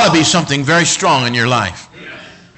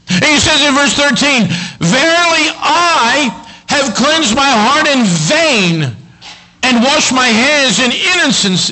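high, loud pulpit scripture reading; fired-up preacher building in intensity; full-force preaching; 10 semitones higher, 5 dB louder than usual. Acted. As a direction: declaim the verses, stretching the key words.

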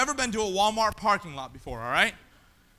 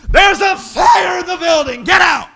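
slow, loud, higher yell of alarm; urgent warning shout; 7 semitones higher, 10 dB louder, slower than usual. Retold or imitated. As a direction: clear the building now. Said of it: "they're gonna say".

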